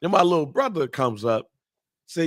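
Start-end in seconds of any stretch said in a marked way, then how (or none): none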